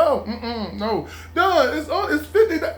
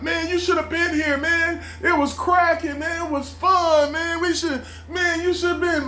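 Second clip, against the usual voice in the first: higher, excited friend bragging about the party; 8 semitones higher than usual. Retold or imitated. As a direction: rub it in like a buddy on the phone.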